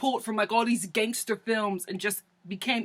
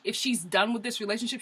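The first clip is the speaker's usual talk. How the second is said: ordinary delivery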